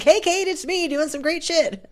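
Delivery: high, slow, upbeat mock voicemail greeting; imitating her voicemails. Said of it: "I know you're gonna be like".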